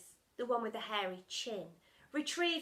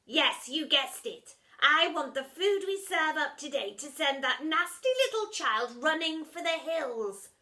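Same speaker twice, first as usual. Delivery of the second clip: scheming villain; 6 semitones higher, 9 dB louder than usual. Acted. Splat voiced, scheming.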